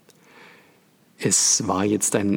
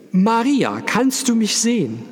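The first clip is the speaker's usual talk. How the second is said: high, loud call; boy calling to a lost woman; 13 semitones higher, 5 dB louder than usual. A narrator's reading. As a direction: call across the dark room.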